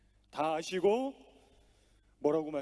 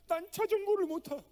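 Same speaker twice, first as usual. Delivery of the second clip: put-on crying little girl; 13 semitones higher, 5 dB louder than usual. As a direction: whine it like a sobbing daughter. Crying daughter speaks to father.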